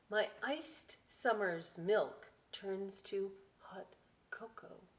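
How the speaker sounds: normal talking voice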